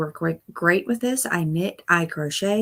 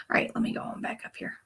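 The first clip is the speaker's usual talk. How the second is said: fast unvoiced whisper to herself; 7 dB quieter, faster than usual. Real, not acted. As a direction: whisper it quickly under your breath. Muttering while adjusting stream.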